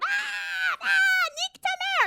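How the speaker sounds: put-on high-pitched toddler tantrum scream in French; piercing mock tantrum; screaming child. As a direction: imitate a child screeching at mom. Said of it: "she's screaming at me in French".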